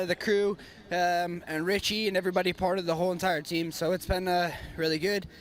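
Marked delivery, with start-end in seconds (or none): none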